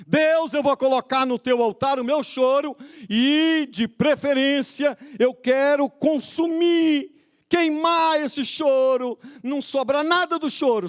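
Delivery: high, stretched-out pleading to God; mock fervent prayer. Preacher imitating believers offering God their tears, mock fervent prayer.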